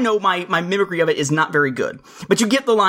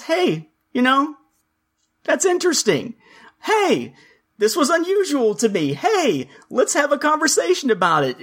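curious commenter; 8 semitones higher than usual. Retold or imitated.

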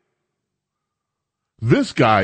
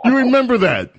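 surprised; 13 semitones higher, 3 dB louder than usual. Real, not acted. Host surprised caller remembers.

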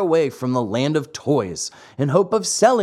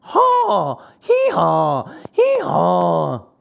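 donkey bray; much slower than usual. Acted. Boys turned donkeys braying out their shame. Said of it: "loud donkey brays".

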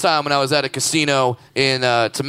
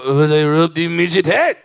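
lightly put-on mock Japanese accent; a clumsy half-hearted Japanese accent. Retelling gamblers, in a slight mock Japanese accent. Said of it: "I'm doing the wrong accent".

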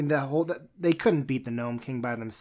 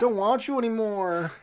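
mock rejection; 8 semitones higher than usual. Continues mock dismissal of dog.

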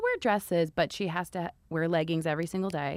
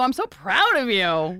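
condescending proud; 5 semitones higher, 7 dB louder, slower than usual. Retold or imitated. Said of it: "or you go".

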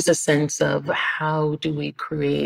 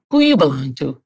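louder opening, falling off as a pointed question; questioning islanders; 6 dB louder than usual. Retold or imitated. Islanders to Jennifer when she comes down, pointedly questioning. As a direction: imitate a local sizing someone up. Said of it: "they're like".

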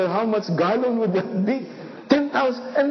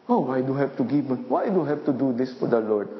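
lower, grumbling whine of reluctant givers; mock complaining; 8 semitones lower, faster than usual. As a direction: sulk it out like a reluctant churchgoer. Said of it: "he could hear the people complaining".

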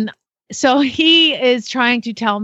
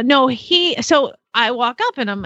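ordinary talk

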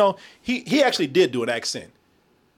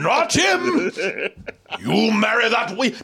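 hammed-up stage tragedian, pitched high; mock Shakespearean actor; 4 semitones higher, slower than usual. Mocking an actor in the wrong movie, hammed-up Shakespearean delivery. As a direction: declaim it like bad Shakespeare. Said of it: "He came here doing Shakespeare".

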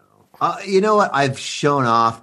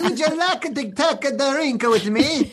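high, hammed-up Swedish pickup line; Swedish accent. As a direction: chat someone up in a mock Swedish accent. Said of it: "Let's hear your Swedish accent".

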